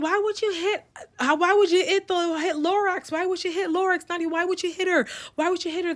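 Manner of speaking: high, accusing mock shout of the group; imitating the kids ganging up on her, shrill and high; mock yelling. The friends yelling at Nadia for hitting Lorax, high mocking shout. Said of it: "Everybody starts yelling".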